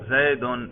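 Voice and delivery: زیدن said as zaidun, ending in -un; nominative ending. Shown as a demonstration. Teacher showing students the raf' case, zaidun with -un. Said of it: "رفع پہ تلفظ کر کے دکھاؤ".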